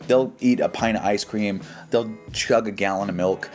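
ordinary talk

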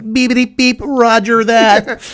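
high, slow, dumb battle droid; mock the lame droid line, drawn out and high. Mocking the prequel droids' lame dialogue, high slow droid voice. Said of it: "they would have the lamest dialogue and be like".

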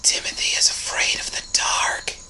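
sizzling, sighing taunt in a whisper; hissing whisper. A narrator's reading. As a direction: hiss the words tauntingly, without any voice. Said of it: "hissing and sizzling and sighing the words".

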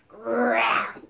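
mock tiger growl; playful animal growl; low growl. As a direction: growl like a big cat. Tiger growl behind door.

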